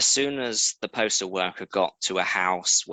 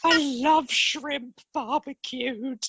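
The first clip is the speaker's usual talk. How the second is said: much higher, squeaky mock voice of an Aussie friend; playful put-on friend voice. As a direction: pitch it way up and gush like a cartoonish Australian. Voicing Australian friend Hayley jokingly.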